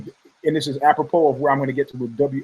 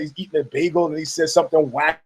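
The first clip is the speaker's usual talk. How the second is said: ordinary talk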